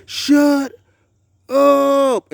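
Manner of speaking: high, loud retold yell; stretched-out yelled order; yelled shut up. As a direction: bark it at the noisy animals behind the door. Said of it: "I said".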